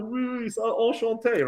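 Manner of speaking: high, embarrassed polite French; flustered French. Retold or imitated. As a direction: stammer a polite French greeting. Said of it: "I'm like".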